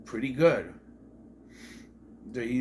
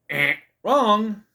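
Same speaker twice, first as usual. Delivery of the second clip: high, loud wrong-answer buzzer; emphatic, playful rejection; mock buzzer correction; 6 semitones higher, 7 dB louder than usual. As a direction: buzz them out like a quiz judge. Game-show buzzer style correction.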